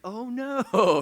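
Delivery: high, loud mock panic "Oh no"; mock alarm. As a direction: ham up the fright. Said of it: "some of you are like".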